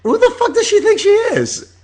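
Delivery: shrieking offended outcry; outraged disbelief. Retold or imitated. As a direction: blast it like she crossed a line. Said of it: "I was like".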